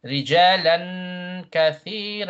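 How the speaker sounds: slow chant with held nasal hum; tajweed recitation. Shown as a demonstration. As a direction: draw out the long vowels and hidden N.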